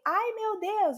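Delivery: high Ai, meu Deus; excited exclamation. Acted. Teacher retells the shopper line in Portuguese: high excited exclamation. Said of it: "Eu falei".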